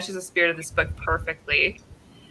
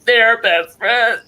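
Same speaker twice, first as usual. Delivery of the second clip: mock sob; 3 semitones higher, 5 dB louder, much slower than usual. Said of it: "and I was like".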